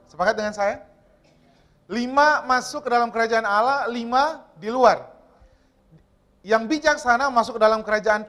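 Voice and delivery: loud, driving sermon voice; preaching at full force. Acted. Preacher tells the congregation the parable, loud and driving. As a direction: proclaim the parable to the church.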